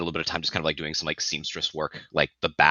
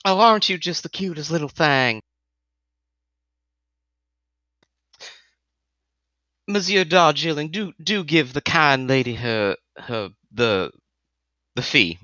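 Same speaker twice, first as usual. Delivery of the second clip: high, syrupy Southern belle; 10 semitones higher than usual. Acted. Shay cooing at the hostess and her escort.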